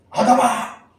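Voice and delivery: high, forceful shout of a name; loud call. Retold or imitated. God first calling out to Adam, loud high shout. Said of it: "그래서 바로 바꾸십니다".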